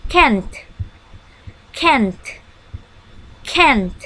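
can't with a clear t at the end; final t sound. Shown as a demonstration. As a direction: make the t at the end of can't heard. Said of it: "Tapi ada teh di belakang can".

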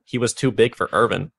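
deadpan dramatic fanfiction reading; mock fanfic reading. Podcaster reading fanfiction to cohost, deadpan storytelling. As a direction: read the bad fanfic aloud with a straight face. Reads fanfiction aloud, mocking.

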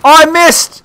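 high cry of dismay; frustrated outcry. Real, not acted. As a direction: cry out at the miss. Outcry on missing a shot.

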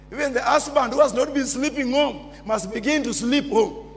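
ordinary talk, nothing marked

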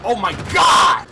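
loud, slow, high cry; shout. Real, not acted. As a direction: yell it out. Shouting at the game.

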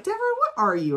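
high-pitched "what are you", falling at the end; acted questioning. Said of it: "She's like".

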